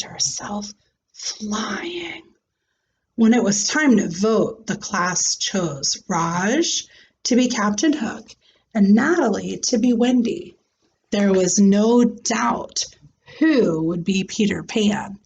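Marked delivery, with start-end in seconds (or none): none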